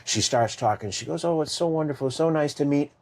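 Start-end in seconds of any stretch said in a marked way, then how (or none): 1.2-2.9 higher, sugary gushing greeting; mock gushing. Governor greeting a survivor, in mock gushing tones. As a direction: lightly imitate a fake-sweet politician. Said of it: "like that".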